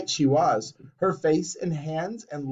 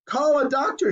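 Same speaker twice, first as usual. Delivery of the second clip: high, urgent shout; 10 semitones higher than usual. A narrator's reading. Father shouting for a doctor, high. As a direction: shout for help. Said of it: "shouted Mister Beauregard".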